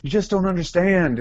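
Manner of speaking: high, pleading protest; whiny protest. Retold or imitated. To Danielle, high whiny protest. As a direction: whine it like a starstruck fan.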